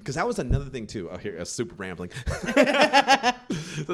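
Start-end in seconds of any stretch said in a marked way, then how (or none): 2.1-3.8 high burst of laughter; high embarrassed laugh; loud laugh. Real, not acted. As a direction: laugh at yourself. Laughs at own rambling.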